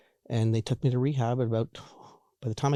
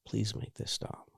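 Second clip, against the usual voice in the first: hushed, barely voiced plea; retold quiet plea; 11 dB quieter, slower than usual. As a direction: plead under your breath, slowly. Retold desperate plea from bed.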